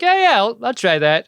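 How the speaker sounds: high-pitched polite agreement, settling back down; mock-polite brush-off; feigned politeness. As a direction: humour them with a too-eager yes. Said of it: "that polite thing you do".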